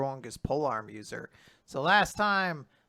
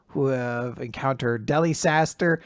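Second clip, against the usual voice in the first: ordinary talk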